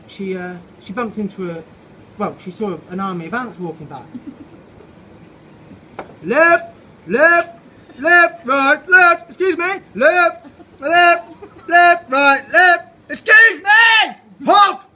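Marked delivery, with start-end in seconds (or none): 6.2-14.8 very high, very loud marching bark; drill sergeant shout. Acted. Ant sergeant drilling the army, shouting cadence. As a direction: bellow the march like a parade sergeant.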